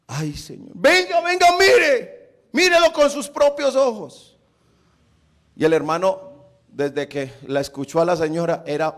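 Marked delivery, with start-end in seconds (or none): 0.1-0.7 dismayed prayer. Retold or imitated. Missionary dreading what happened. 0.7-4.4 high-pitched, insistent woman's call; acted alarm, pitched sharply up; urgent summons.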